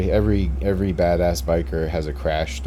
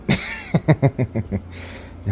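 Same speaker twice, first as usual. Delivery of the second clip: high, breathy run of laughter; 8 semitones higher than usual. Real, not acted. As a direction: burst out laughing after making the point. Laughs after his conclusion.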